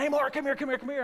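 high, insistent imitation of a yell; pestering uncle's high-pitched holler; mock yelling. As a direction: keep hollering for him to come over. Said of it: "he just kept yelling".

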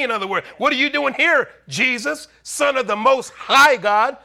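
high-pitched, urgent sermon delivery; impassioned preacher voice, pitched high; full-force preaching. Real, not acted. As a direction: retell it with rising preacher fervor.

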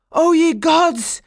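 high, loud, slow anguished cry. A narrator's reading. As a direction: cry out to the heavens in grief. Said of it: "he cried out".

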